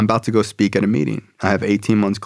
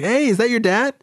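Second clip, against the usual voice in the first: high, hollered greeting, acted; eager calling out; 14 semitones higher than usual. Carl overhearing and butting in, calling out eagerly. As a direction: call across the house as the friend. Carl calls out to meet dad.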